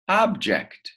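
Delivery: teacher's high, clear model of "Object"; bright lifted model word. Shown as a demonstration. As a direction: sing out "Object" high, then drop. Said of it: "Another word".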